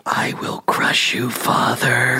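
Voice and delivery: hushed villain threat; menacing whisper. Pretend. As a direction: whisper it like a barbarian warlord. Joking menacing letter voice-over.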